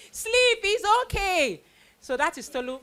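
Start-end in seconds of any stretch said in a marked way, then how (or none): 0.1-1.6 high, slow, pleading bedtime plea; tired parent. Retold or imitated. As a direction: beg the kids to go to sleep. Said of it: "We have to say".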